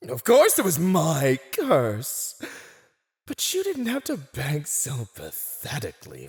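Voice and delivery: high, loud villainous exclamation; gloating boast. Acted. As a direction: boast as if it were obvious.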